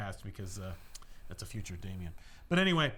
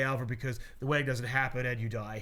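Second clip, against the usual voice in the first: usual speaking voice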